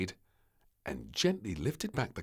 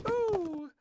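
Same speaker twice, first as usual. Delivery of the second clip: high ooh. Acted. Searching the cupboards.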